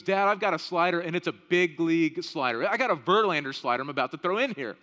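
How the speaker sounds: a hint of kid swagger; boasting six-year-old. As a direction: boast to Dad like a little boy. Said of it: "And he goes".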